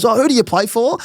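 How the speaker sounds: high mock bouncer voice; bouncer asking. Doorman asking which club he plays for, mock voice. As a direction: ask it like the doorman. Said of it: "He goes".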